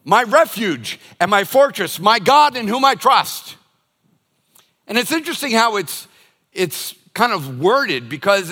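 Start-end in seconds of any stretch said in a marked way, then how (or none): 0.0-3.5 psalm recitation. Acted.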